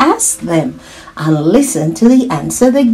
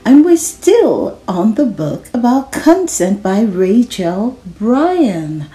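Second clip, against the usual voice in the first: usual speaking voice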